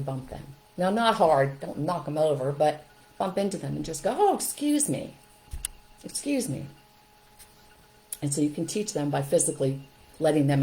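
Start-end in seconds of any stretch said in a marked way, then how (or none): 4.2-6.7 high startled apology; surprised "oh excuse me". Shown as a demonstration. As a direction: pretend bump, quick sorry. Demo bumping into child.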